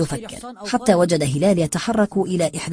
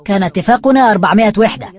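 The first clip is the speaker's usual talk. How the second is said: loud, higher, fast protest; raised-voice insistence, quick and high; indignant shout; 4 semitones higher, 8 dB louder, faster than usual. A narrator's reading. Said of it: "فصاح خالد مجددا".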